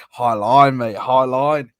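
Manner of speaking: put-on Ange's Aussie accent. Friend mimics the manager in a broad Australian accent. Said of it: "he could go into full Aussie mode".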